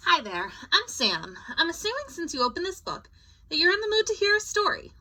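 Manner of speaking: high, quick, chatty narrator character; bright storybook host voice; Sam's voice. Acted. Sam character greets reader.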